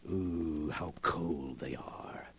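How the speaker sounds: slow, hushed and relishing; a low creepy murmur over the hands; gloating whisper. Acted. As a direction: savour the chill in a whisper. Villain caresses dead hands.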